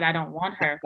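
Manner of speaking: low, soft, reluctant refusal; understated, muted no; low-key refusal. Retold or imitated. First refusal to take trainee.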